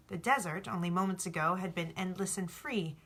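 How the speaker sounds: ordinary delivery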